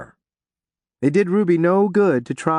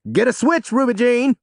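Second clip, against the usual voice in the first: ordering; 10 semitones higher, 3 dB louder than usual. A narrator's reading. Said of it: "Reverend Upshaw ordered".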